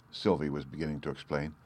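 usual speaking voice

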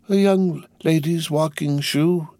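put-on high, slow, detached old shoemaker; drawn out, lost in the work; far-away high murmur, not addressing anyone; absent muttering. A narrator's reading. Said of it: "he muttered without looking up".

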